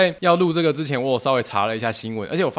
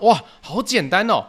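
delighted wow; 6 semitones higher, 4 dB louder than usual. Retold or imitated. Naive new hire hears easy task.

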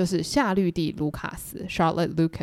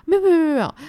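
panicked, higher and louder; frantic mother rushing to reassure; 10 semitones higher, 6 dB louder, much faster than usual. Retold or imitated. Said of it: "班奈特太太更紧张". (慌张地连声否认，声音更高更响)

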